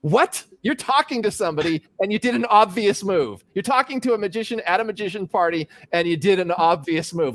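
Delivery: a hint of an exasperated mentor telling off a novice; mock scolding. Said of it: "he gave me the talking to of my life".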